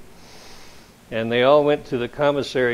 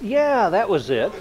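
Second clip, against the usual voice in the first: naive cousin; 8 semitones higher than usual. Retold or imitated. Farm cousin confirming.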